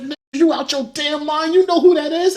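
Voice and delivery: very high-pitched incredulous telling-off; alarmed scolding. Retold or imitated. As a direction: scold a friend in a high, disbelieving voice. Retold scolding by alarmed friend.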